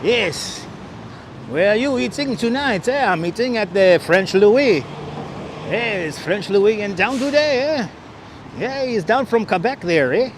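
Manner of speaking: put-on Québécois voice; mock French-Canadian; French-Canadian accent. Mock French-Canadian diner banter.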